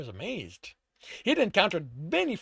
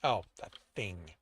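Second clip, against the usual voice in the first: ordinary delivery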